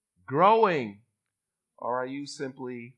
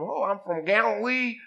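higher, louder mock Galilean accent; bad Galilean dialect; 8 semitones higher, 6 dB louder than usual. Galilean volunteering to read the Torah, mock Galilean accent. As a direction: put on a hammed-up country Galilean accent. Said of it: "That's how bad their dialect was".

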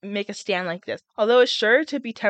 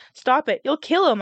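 higher, louder desperate plea; shriek; 6 semitones higher, 5 dB louder than usual. A narrator's reading. Katniss shrieking at the peacekeeper to stop, higher and louder. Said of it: "I shrieked".

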